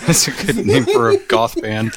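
ordinary delivery

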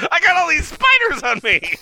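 high squealed fake fright; mock panic. Customer squealing in mock panic. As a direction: squeal as if covered in spiders.

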